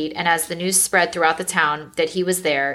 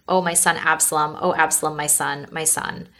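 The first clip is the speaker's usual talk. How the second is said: usual speaking voice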